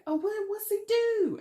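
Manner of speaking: very high, loud southern voice; pry eagerly in a high southern accent. Acted. Religious family asking about the guy you like, high southern accent. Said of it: "I put on this southern accent".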